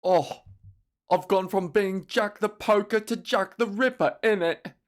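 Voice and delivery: a hint of London geezer; mock British self-pity; cockney musing. Continuing cockney character.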